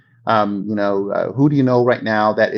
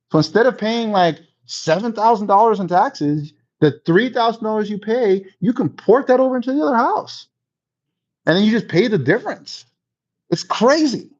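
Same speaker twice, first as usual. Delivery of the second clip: higher, excited explainer; excited tip; 8 semitones higher than usual. Shown as a demonstration. Agent wowing a friend with a tax trick, excited. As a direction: sell the wow of it. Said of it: "inflection in your voice".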